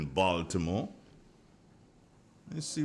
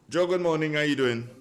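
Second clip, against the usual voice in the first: loud greeting; 5 semitones higher, 7 dB louder than usual. Real, not acted. Greeting caller on air.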